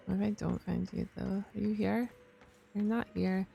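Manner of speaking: usual speaking voice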